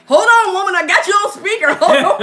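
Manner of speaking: high, loud hurried husband; husband on speaker. Retold or imitated. Husband on phone with sergeant, loud and high.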